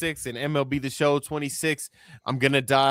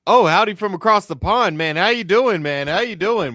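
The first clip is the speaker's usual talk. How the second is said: high, loud hammed-up Southern country greeting; a big friendly country howdy, played up for laughs; country accent; 7 semitones higher, 8 dB louder than usual. Host greeting a British guest in a mock country accent. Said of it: "they got country accents across the pond".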